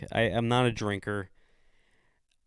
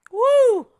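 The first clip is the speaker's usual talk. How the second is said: loud high whoop; 12 dB louder than usual. Real, not acted. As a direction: let out a wild cheer.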